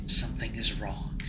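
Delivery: hushed, breathless whisper of a voice; eerie whisper. Acted. Unseen voice whispering to frightened Cameron, hushed eerie whisper. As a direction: breathe it like a voice in his head.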